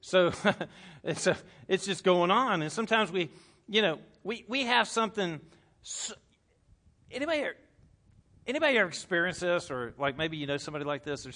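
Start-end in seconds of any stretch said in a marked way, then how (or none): none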